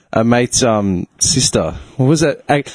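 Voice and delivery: ordinary delivery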